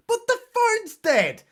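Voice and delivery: high squeaky mock-horror reveal; a sudden high-pitched fake gasp; mock dramatic gasp. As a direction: deliver it like a cheesy movie twist.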